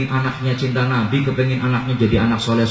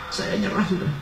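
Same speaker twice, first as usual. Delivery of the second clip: much softer, resigned surrender; giving up; 5 semitones higher, 9 dB quieter than usual. Retold or imitated. Companion admitting he cannot follow the Prophet, softly giving up. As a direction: let the voice fall away in defeat. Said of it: "sampai nyerah".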